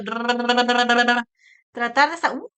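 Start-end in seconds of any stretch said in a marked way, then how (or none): 0.0-1.2 lips buzzed with a finger; finger-on-lips trill. Shown as a demonstration. Therapist shows finger lip vibration, buzzing trill. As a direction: flap the lips with a finger while humming. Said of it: "o con el dedo".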